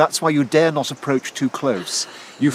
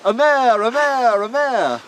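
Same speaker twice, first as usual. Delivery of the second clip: higher triumphant call, fading to the last; shout; 10 semitones higher, slower than usual. Shown as a demonstration. Professor demonstrates the winner's cry, high shout. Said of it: "And you shout".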